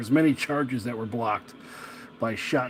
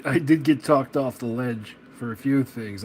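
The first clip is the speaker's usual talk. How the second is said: ordinary talk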